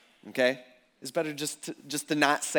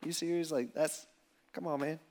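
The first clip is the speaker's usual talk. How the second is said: quiet, let-down Jesus, much softer; a quiet disappointed aside to Peter; 9 dB quieter than usual. Retold or imitated. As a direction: hush the disbelief under your breath. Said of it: "he's just like".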